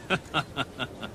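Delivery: gloating laugh. Acted. Commissioner gloats about danger.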